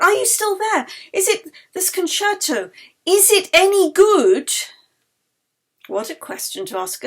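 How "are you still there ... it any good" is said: high, loud, rushing questions; eager questions. Acted. Yeli eagerly and loudly questioning the spirit. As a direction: fire questions at the spirit, thrilled.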